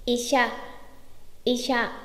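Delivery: "Isha" with a clear hushing sh; correct "sha" sound. Shown as a demonstration. Teacher modelling a Japanese word for learners, "Isha" with sh. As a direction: say "Isha" with sh, never a plain s. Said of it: "Chú ý chữ sha không phải là sa".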